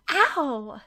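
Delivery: loud, high yelp of pain; shouted ow. Acted. Friend pokes her sunburned back, loud pained yelp. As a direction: cry out when the sunburn is poked. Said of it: "Sakura shouted".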